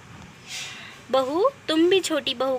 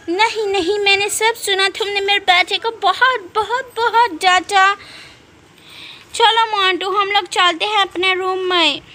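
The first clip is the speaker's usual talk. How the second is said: loud, high indignant scolding; mother furiously defending her boy; angry; 4 semitones higher, 6 dB louder than usual. Acted. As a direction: snap back defending your son. Mother angrily defends her son.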